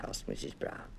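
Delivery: plain reading voice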